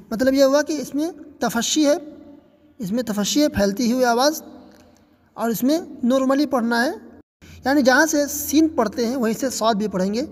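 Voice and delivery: ordinary talk, nothing marked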